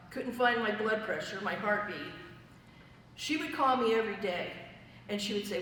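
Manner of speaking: ordinary talk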